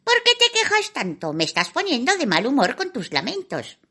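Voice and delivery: high irritated fairy complaining; grumpy fairy. Acted. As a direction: snap at the whiner, impatient. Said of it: "se quejó el hada".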